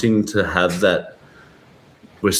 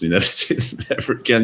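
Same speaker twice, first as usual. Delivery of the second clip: normal talking voice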